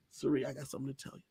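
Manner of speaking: quick, low and very soft; hushed confiding. Retold or imitated. Houseguest takes ally outside to confide, hushed. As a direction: let someone in on a secret, under the voice. Acting out Jag's secret confession.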